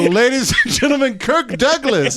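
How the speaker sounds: high, booming showman announcing a star; hammed-up stage announcer; mock announcer. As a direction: announce him like an awards show host. Mock awards show announcement.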